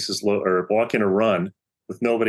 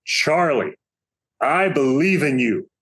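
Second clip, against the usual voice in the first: higher-pitched, drawn-out call, a restrained yell; retold yell; 5 semitones higher, slower than usual. Coach to struggling pitcher from the dugout, retold yell. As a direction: imitate a coach calling through cupped hands. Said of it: "cups his hands to his mouth and he yells".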